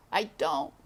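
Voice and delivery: high, insistent mouse voice. A narrator's reading. Despero insists to the knight, high. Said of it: "said Despero".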